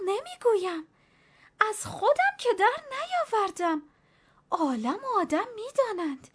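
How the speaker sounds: put-on high-pitched, fretful old nanny; lightly voiced superstitious old nanny; old servant woman. A narrator's reading. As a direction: let the old woman ramble anxiously.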